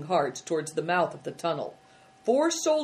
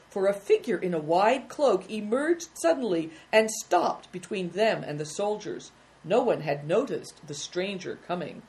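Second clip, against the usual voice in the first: ordinary delivery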